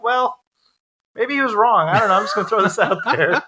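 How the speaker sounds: mock suggestion. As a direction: offer it lightly, half laughing.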